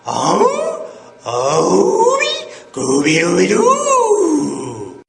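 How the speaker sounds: loud, drawn-out dog howl that cracks upward; Scooby-Doo. Shown as a demonstration. Yodel teacher imitating a cartoon dog, cracking howl. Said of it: "Scooby-Doo is a yodeler".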